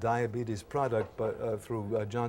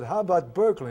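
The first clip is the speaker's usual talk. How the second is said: pointed loud question; 8 semitones higher, 9 dB louder, faster than usual. Retold or imitated. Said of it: "I said".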